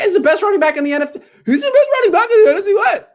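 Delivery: so worked up the voice breaks, laughing through it; voice cracking. Real, not acted. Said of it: "my voice starts cracking".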